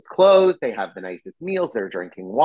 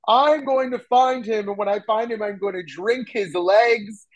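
high, far-off mock giant threat, slowing at the end; 10 semitones higher than usual. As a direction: threaten like a dim giant groping for the word. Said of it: "he goes".

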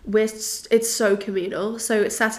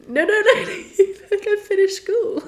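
laughing squeal; 12 semitones higher, 4 dB louder than usual. Retold or imitated. Teacher thinks she is a child.

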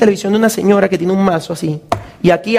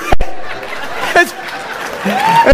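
breathless laugh. Real, not acted.

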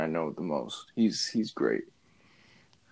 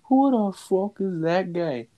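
mock-tough Irish accent, higher and slow; 9 semitones higher, slower than usual. A hard man who thinks he's badass, slow mock Irish accent. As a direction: do Conor McGregor's swagger. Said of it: "he's just like".

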